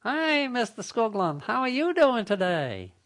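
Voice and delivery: cheery automated caller voice; robot telemarketer. Pretend. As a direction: chirp a canned sales greeting. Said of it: "a robot reaches a live person".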